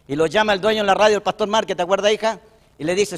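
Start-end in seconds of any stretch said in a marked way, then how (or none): none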